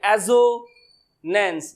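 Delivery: Assonance with a long break before नेंस; split into syllables. Shown as a demonstration. Teacher showing students the syllables, slowly split. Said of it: "दो syllables है".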